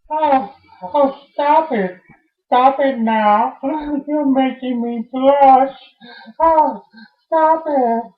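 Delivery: high, flustered "stop it"; coy protest. Acted. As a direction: protest while loving the attention. Coy protest at being admired.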